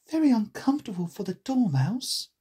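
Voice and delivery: a hint of a girl character; Alice. A narrator's reading. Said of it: "thought Alice".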